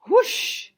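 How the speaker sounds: very high, loud whoosh; whoosh sound effect. Acted. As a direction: burst out like spraying water. Water spray sound.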